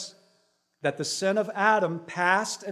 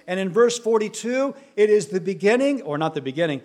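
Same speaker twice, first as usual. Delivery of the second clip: ordinary talk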